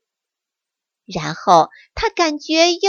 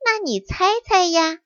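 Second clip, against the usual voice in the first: higher, teasing hush; mysterious; 7 semitones higher than usual. Acted. Fox teasing the bear, mysterious. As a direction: keep the secret, playfully. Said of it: "小狐狸神秘地说".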